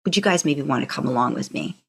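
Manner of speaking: normal talking voice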